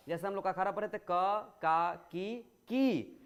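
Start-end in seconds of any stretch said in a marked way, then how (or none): 2.1-3.2 की stretched into a long ई sound; long vowel. Shown as a demonstration. Teacher shows his students the long vowel in की, stretched slowly. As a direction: draw out की slowly to show the long ई. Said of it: "उस पर हम लोग जोर देते हैं".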